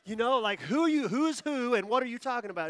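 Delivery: loud, high confused shout; baffled. Retold or imitated. A pastor, baffled, shouting back at a crying stranger. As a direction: throw it back in total confusion. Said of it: "I'm like".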